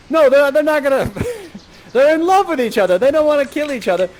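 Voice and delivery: high, loud, half-laughing protest; amused protest. Real, not acted. Storyteller stops the lovers fighting, high amused protest. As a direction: object loudly with a laugh breaking in.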